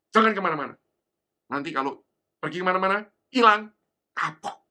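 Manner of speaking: loud, stern warning, slowing on the last words; stern mother warning. Retold or imitated. Mother warning her little son to stay put, loud and stern. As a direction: warn the child sharply not to wander off.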